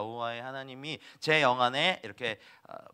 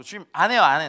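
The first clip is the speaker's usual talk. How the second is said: exasperated refusal; 3 semitones higher, 11 dB louder than usual. Retold or imitated. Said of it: "정말 화가 나가지고".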